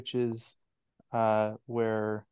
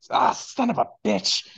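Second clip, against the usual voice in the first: higher, louder pained curse; disgruntled curse; 10 semitones higher, 4 dB louder than usual. Acted. Tabaxi smith cursing his smashed hand, higher and louder. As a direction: swear at the hammer that just hit you. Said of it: "a disgruntled voice go".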